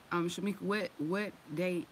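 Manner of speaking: usual speaking voice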